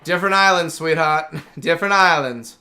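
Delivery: put-on a playful, half-laughing try at Bernie's accent. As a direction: lightly do Bernie's accent, calling someone sweetheart. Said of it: "how would Bernie say in her accent".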